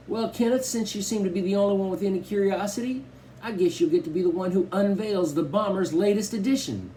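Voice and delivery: high, grand teasing host voice; playful showman. A narrator's reading. Dad teasing his family before the big reveal, showman voice. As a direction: play the proud showman dad.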